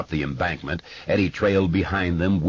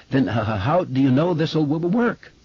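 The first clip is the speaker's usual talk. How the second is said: stutter; 9 semitones higher than usual. A narrator's reading. Bill stutters.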